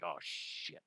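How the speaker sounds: breathy whispered curse under the breath; hushed mock dismay; retold whisper. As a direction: whisper it like a shocked aside. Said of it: "and I'm like".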